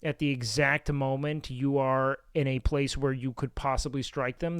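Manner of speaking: ordinary delivery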